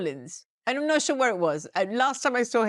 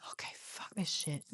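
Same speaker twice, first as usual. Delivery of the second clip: whisper; 8 semitones lower, 16 dB quieter than usual. Retold or imitated. Tired actor's inner complaint.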